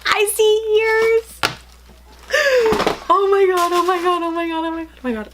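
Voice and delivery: high-pitched thrilled squeals; shrieking jackpot winner. Real, not acted. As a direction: squeal with delight over a prize. Unboxing Disney ears, huge excitement.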